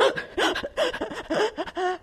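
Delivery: sharp high panicked gasps for air; hyperventilating gasps. Retold or imitated. Child hyperventilating between screams, gasping. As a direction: gasp like a hysterical bleeding child. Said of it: "she was going".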